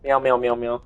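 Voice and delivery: quiet playful meowing; soft cat meows. Pretend. Imitating a cat to the viewers, soft playful meows. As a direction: meow like a little cat.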